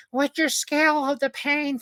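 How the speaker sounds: very high put-on chirpy voice; squeaky imitated question; mock hospital lady. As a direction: mimic an overly sweet staffer in falsetto.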